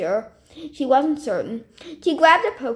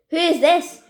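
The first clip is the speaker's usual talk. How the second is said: louder, higher irritated demand; irritable; 6 semitones higher, 6 dB louder than usual. Acted. Caller demanding Stephanie's name, irritable louder question. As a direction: snap the question impatiently. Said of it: "more irritably this time".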